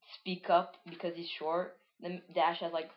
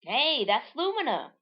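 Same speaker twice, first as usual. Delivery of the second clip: high, loud character exclamation; Edward's voice; 6 semitones higher, 4 dB louder, slower than usual. Acted. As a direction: draw out the last words, pitched up. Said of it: "says Edward".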